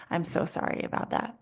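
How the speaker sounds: 轻声耳语般的安慰; soft reassuring whisper. Pretend. Parent soothing upset teen.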